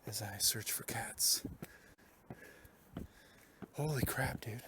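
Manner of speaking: slow whispered ASMR. Acted. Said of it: "A S M R".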